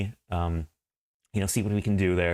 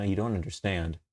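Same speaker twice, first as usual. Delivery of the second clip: usual speaking voice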